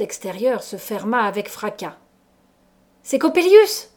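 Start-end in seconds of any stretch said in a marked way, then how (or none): 3.0-3.9 high, loud shout, rising sharply; terrified shriek of recognition; horrified cry. A narrator's reading. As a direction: cry the name out in horror. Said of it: "m'écriai-je avec horreur".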